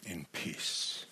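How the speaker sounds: quiet pronouncement of peace; soft blessing. Shown as a demonstration. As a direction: bless her gently, almost under the breath. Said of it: "proclaims a much deeper and larger blessing".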